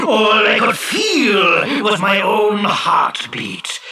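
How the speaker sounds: slow, booming, high-pitched genie voice; aggrieved genie. Acted. Genie tells the fisherman his story, booming bitterly. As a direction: draw out each grievance grandly.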